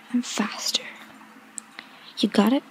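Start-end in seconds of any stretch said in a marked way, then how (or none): 0.1-0.8 breathy seductive ASMR whisper; sultry whisper. Acted. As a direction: whisper close to the mic, teasing.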